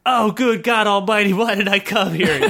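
mock anguished yell; hammed-up yell. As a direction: yell out like a man in agony, hammed up. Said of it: "yelled the now very meaty warrior".

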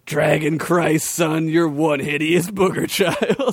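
slow, scornful warrior voice; burly warrior. Acted. Warrior sneering at Gregory, slow scornful voice. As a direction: talk down to the kid slowly.

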